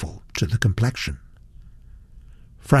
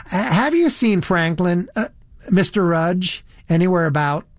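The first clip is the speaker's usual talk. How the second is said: put-on higher, hesitant girl's voice; high-voiced American girl; 6 semitones higher than usual. A narrator's reading. Elizabeth asking Satterthwaite after Franklin, high hesitant voice. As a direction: ask awkwardly, correcting yourself. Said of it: "a rather high, shrill voice".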